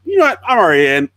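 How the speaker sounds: blurted out like a sudden decision; mock eureka. Actor retold dropping his fake accent, mock eureka.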